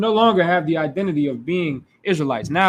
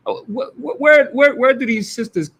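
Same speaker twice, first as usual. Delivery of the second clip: incredulous outburst; 7 semitones higher, 6 dB louder than usual. Real, not acted. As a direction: voice leaps high in shocked disbelief. Outraged disbelief at mothers' talk.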